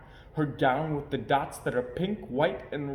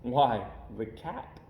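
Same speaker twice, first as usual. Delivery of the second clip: ordinary delivery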